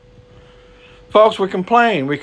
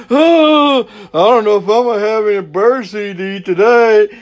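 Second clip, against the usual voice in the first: worried bird voice; 3 semitones higher than usual. Retold or imitated. Preacher imitating a fretting bird for his congregation, mock-worried. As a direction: play a lightly voiced bird worrying about its seed. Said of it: "you don't see a bird on a tree going".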